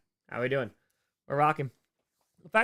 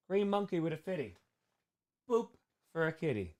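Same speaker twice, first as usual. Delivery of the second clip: silly character voice; 5 semitones higher, 6 dB quieter than usual. Acted. Absurd bedtime story character outburst.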